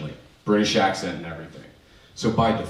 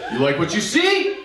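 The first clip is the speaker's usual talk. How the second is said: higher, softened flirt line; demo of the flirt; 10 semitones higher than usual. Shown as a demonstration. To the audience, showing how it looked, a higher grinning flirt. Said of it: "this is what it looked like".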